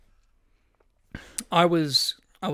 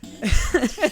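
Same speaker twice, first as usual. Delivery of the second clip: high burst of laughter; 9 semitones higher, 5 dB louder than usual. Real, not acted. Laughing at own story.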